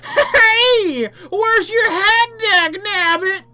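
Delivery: very high, loud, slow shriek; shrieking crazy-eyed old coot. Acted. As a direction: screech like a wild-eyed prospector. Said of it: "shrieks and waves".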